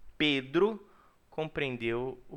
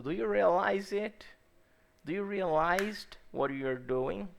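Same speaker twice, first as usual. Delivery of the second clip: playful question; 6 semitones higher than usual. Shown as a demonstration. Teacher asks class playfully.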